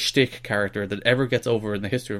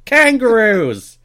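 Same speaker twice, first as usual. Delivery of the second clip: put-on Australian accent; 11 semitones higher, 6 dB louder, much slower than usual. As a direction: ham up a broad Australian accent. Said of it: "all of my Australian stereotypes".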